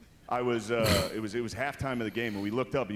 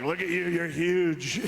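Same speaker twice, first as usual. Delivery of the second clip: slow, higher, louder Trump voice; Trump impression; 6 semitones higher, 5 dB louder, much slower than usual. Comic quoting Trump greeting him at the line's end, slow and louder. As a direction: stretch out huge like Trump. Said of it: "he was like".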